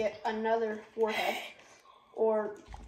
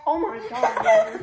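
laughing through sourness; breathless laugh at the sour taste; 4 semitones higher, 6 dB louder, much slower than usual. Real, not acted. Reacting to sour candy.

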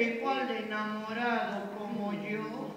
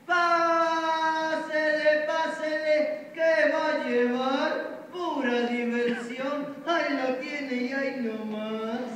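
fairground barker; 3 semitones higher, 4 dB louder than usual. Acted. Barker calling in the crowd.

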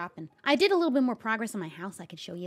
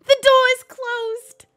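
incredulous; 9 semitones higher, 7 dB louder, much slower than usual. Real, not acted. Trapped, door shut behind.

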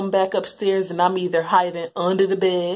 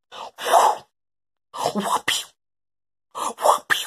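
whoop sound effect; 5 dB louder, much slower than usual. Retold or imitated. Belt whipping sounds imitated.

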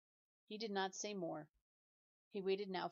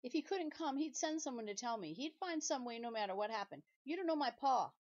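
higher, rising to a loud outburst; higher, louder desperate insistence; upset pleading; 6 semitones higher, 5 dB louder than usual. Acted. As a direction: defend his pa, near tears and getting louder. Mat pleads about father.

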